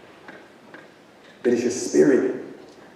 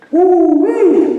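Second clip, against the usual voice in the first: higher and louder, whooping; a joyful high whoop; excited woo-wee; 13 semitones higher, 5 dB louder than usual. Real, not acted. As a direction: let out a big whoop.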